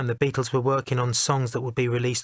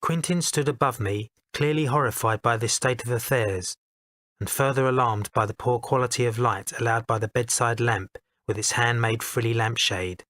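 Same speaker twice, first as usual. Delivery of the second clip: ordinary delivery, nothing marked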